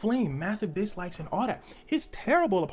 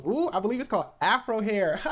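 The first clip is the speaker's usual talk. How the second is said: higher laughing put-on voice; mock sister laughing; 7 semitones higher than usual. Sister teasing Shane about hair, laughing mock voice. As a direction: giggle through it, teasing.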